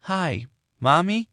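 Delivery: put-on small child piping on the phone; toddler voice. Little Amy answering her mother on the phone, high toddler voice.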